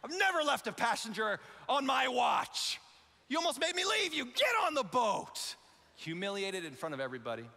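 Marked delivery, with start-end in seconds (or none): 0.0-5.6 stern berating captain. Retold or imitated. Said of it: "Stern face, berating them".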